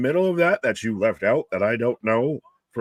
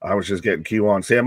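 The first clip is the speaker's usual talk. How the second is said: ordinary talk, nothing marked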